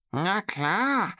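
high, squawky little creature voice; chattering creature. A narrator's reading. Small creature agreeing while eating chips, chattering. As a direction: chatter it in a high cartoon pitch. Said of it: "schnatterte das Squeezelbiesel".

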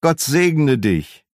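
slow, warmly cheerful blessing; a nephew's happy greeting. A narrator's reading. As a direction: bless him with glad goodwill. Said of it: "rief eine fröhliche Stimme".

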